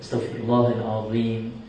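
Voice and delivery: quiet Astaghfirullahalazim with dh in azim; corrected take, softly. Retold or imitated. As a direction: redo it softly with dh. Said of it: "ulang balik".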